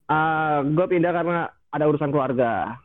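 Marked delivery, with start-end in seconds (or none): none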